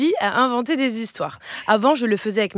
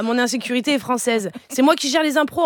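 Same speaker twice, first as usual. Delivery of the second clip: ordinary talk, nothing marked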